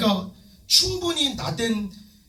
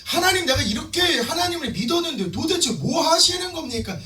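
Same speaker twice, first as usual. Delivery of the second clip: acted, high-pitched protest to God; resentful complaint; 6 semitones higher than usual. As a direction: plead indignantly, imitating a disappointed believer. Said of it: "이렇게 원망하지 마시고". (높은 음으로 하나님께 원망하는 흉내)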